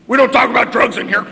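put-on loud, high, barking reproach from an old drunk; gruff old drunk scolding. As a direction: bark it at him like an offended old-timer.

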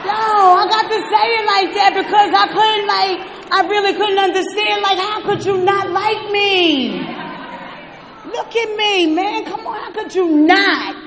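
loud, high, worked-up rant; fired-up. Real, not acted. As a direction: pump it up with swagger. Fired-up rant about self-love.

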